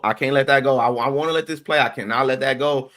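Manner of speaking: ordinary talk, nothing marked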